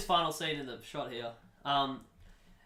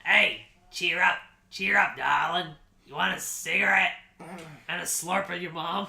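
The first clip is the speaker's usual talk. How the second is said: E T; 3 semitones higher, 5 dB louder than usual. Acted. E T offering a crying child a cigarette, loud character voice. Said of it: "E T goes".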